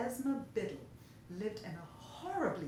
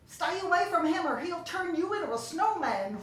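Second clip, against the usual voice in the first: fearful warning; 8 semitones higher, 10 dB louder than usual. A narrator's reading. Esma warns loudly.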